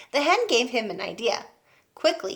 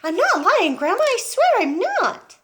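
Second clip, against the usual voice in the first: put-on protesting boy; 12 semitones higher, 5 dB louder than usual.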